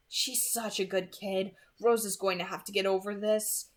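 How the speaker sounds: thought to himself. A narrator's reading. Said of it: "he thought to himself".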